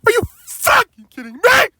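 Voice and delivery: high furious shout. Acted. James shouts at being sent for the coaches. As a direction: blast it out in disbelief. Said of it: "shouted James".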